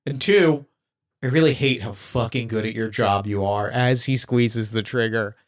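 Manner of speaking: ordinary delivery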